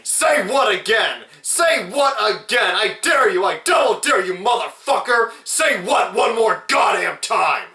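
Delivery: high, loud hammed-up roar; mock furious shout. Reviewer acting out Jules threatening Brett at gunpoint, shouting. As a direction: scream the dare at him.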